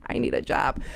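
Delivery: whispered under the breath; hushed mock aside. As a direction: whisper it as a secret thought. Private thought, hushed.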